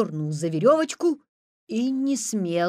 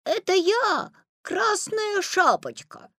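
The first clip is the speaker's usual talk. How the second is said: put-on high, slow girlish voice; little girl voice; 12 semitones higher, slower than usual. A narrator's reading. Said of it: "и соврал".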